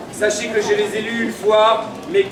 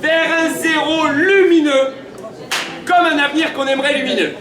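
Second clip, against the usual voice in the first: town crier; 6 semitones higher than usual. Acted. Ceremonial proclamation.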